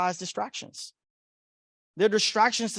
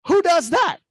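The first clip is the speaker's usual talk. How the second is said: high, loud incredulous outburst; loud, high-pitched mock outrage; mock disbelief; 11 semitones higher, 9 dB louder than usual. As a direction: cry out in comic disbelief.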